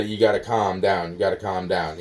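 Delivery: ordinary talk, nothing marked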